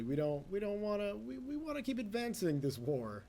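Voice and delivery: usual speaking voice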